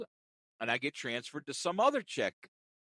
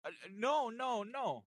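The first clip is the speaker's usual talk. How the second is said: agent voice; 7 semitones higher, 4 dB quieter, slower than usual. Retold or imitated. Agent denies.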